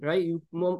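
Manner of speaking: normal talking voice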